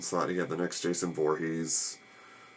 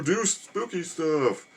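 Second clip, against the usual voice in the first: high, slow mock-eerie moan; mocking the Druids with a drawn-out ghostly tone; spooky voice; 10 semitones higher, much slower than usual. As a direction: ham it up like a campfire ghost story. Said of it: "they say".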